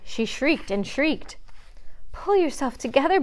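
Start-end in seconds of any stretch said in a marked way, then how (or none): 2.1-3.2 high-pitched, urgent begging. A narrator's reading. Bambi urging shrieking Faline to compose herself, begging. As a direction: beg them to calm down. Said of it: "Bambi begged".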